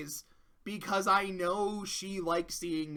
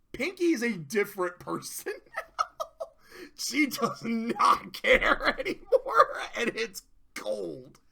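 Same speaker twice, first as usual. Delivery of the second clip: laughing; 3 semitones higher, 3 dB louder than usual. Real, not acted. Laughing over Pinky's change.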